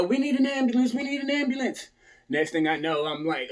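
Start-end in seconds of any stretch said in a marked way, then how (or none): 0.0-1.9 high-pitched imitation of a radio call for help; retold emergency radio call, pitched way up. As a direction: mimic a responder radioing for help. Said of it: "I hear them on the radio".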